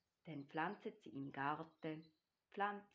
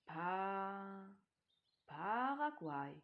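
sounding out a word slowly; stockendes Entziffern; 2 semitones higher, 4 dB louder, much slower than usual. A narrator's reading. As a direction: drag out the syllables like a beginning reader. Tiger deciphers the signpost word. (langsam und gedehnt buchstabierend)

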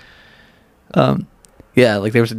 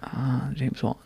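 odd way of saying it; 2 semitones higher, 14 dB quieter, much slower than usual. Real, not acted. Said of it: "Why you say it like that?".

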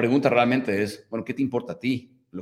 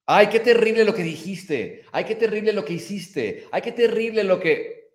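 higher, hammed-up shocked lament; mock dramatic critic; 7 semitones higher, 3 dB louder than usual. Host mocking people who judge her, over-the-top dismay. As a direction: clutch your pearls over "terrible".

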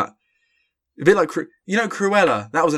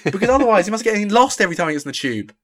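higher and louder, quickening jab; mocking annoyance; 8 semitones higher, 4 dB louder than usual. Real, not acted. Host scoffing at the director's sense of direction, mocking annoyance. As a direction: needle the director with a scornful joke. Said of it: "that's the end of my rant about London".

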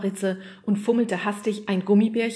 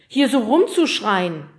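loud, slower, indignant complaint; defensive; 4 semitones higher, 10 dB louder than usual. Acted. Fred complains about the yeti's screaming, defensive. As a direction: draw out the grievance. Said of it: "verteidigte sich Fred".

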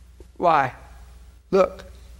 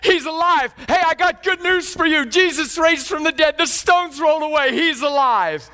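higher, eager shout of good news; excited messenger; 9 semitones higher than usual. Retold or imitated. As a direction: burst in with the news. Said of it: "messengers are coming saying".

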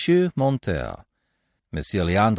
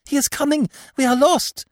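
very high, frightened cry; acted damsel in despair; distracted panic; 4 dB louder than usual. A narrator's reading. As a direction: wail it in alarm, building louder. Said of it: "turning distractedly from one to the other".

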